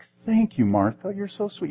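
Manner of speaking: a lightly voiced grateful patient; sweet thanks. Patient thanking the nurse giving her chemo, in a high sweet voice.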